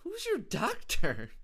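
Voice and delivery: high, slow disbelieving question; can't believe what he heard; incredulous. Real, not acted. Comedian reacting to the president's doctor story, incredulous.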